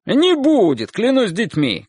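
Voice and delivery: high, insistent shout. A narrator's reading. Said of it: "крикнул мужчина". (настойчивый крик)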